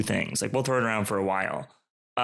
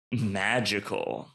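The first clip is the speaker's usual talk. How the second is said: usual speaking voice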